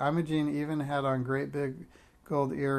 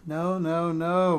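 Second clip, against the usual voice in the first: louder, higher string of refusals; raised-voice mother stopping an argument; exasperated objection; 4 semitones higher, 7 dB louder, much slower than usual. A narrator's reading. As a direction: cut off the squabble, building in force. Mother halts the children's shouting match.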